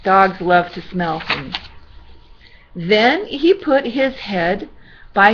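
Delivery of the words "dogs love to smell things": plain reading voice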